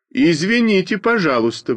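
high indignant objection. A narrator's reading. Schoolboy objects to the class decision, indignant. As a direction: protest indignantly, pitch jumping up. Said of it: "возмутился я".

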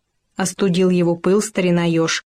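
ordinary delivery, nothing marked

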